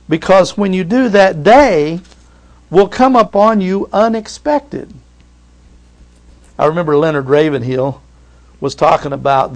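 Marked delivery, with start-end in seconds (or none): 0.1-4.9 higher, louder warning; earnest pulpit warning; preaching warning. Acted. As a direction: warn the congregation with urgency. Climax of the quoted warning.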